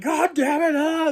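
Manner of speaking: high frustrated cry, not full volume; half-yelled curse at the screen; mock angry outburst. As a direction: fan re-enacting his outburst. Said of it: "I was like".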